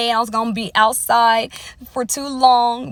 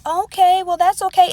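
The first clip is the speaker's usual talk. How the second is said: much higher, louder sing-song okay; mock go-along acceptance; 10 semitones higher, 4 dB louder than usual. People pressured to accept unhealthy habits, singsong and high. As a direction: parody a fake-accepting yes-woman. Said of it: "we supposed to just be like".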